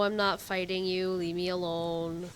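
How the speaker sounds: ordinary delivery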